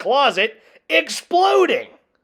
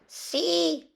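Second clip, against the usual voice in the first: higher little character voice; small dog voice; 10 semitones higher, 6 dB quieter than usual. A narrator's reading. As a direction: squeak it out. Little Poquito voice.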